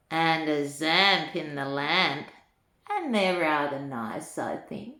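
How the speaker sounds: lilting storybook read-aloud; reading to children. A narrator's reading. Granny reading a rhyming book to children, lilting. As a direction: read the rhymes to little ones with relish.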